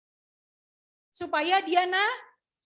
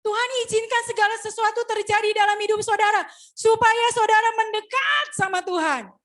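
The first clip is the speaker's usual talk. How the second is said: raised, loud exhortation building to a shout; forceful preaching; 6 semitones higher, 7 dB louder than usual. Real, not acted. Preacher urging congregation to draw near to God, shouted exhortation. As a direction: drive it home at full voice.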